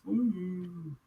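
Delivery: high, drawn-out "woo"; mock wrestler whoop. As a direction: let out a long high woo. Mimicked wrestler's promo whoop.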